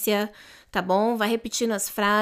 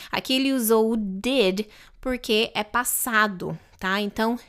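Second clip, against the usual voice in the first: normal talking voice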